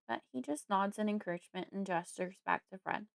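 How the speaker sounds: ordinary delivery, nothing marked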